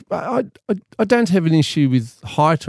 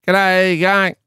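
higher-pitched broad ocker Aussie accent; ocker Australian; 7 semitones higher, 4 dB louder than usual. Shown as a demonstration. Man showing the host an ocker Australian accent, broad Aussie. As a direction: ham up a broad Australian greeting. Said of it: "a really ocker Australian".

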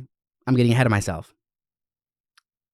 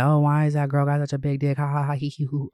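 higher, singsong mock of gossiping staff; mocking imitation; 4 semitones higher than usual. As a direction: mimic their silly giggling. Mocking staff jeering at her.

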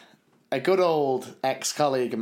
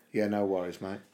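Cockney John; 5 semitones lower, 5 dB quieter than usual. Retold or imitated. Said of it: "I give Cockney John a bell".

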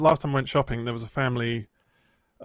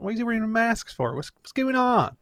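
mock oblivious woman; 9 semitones higher than usual. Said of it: "She's like".

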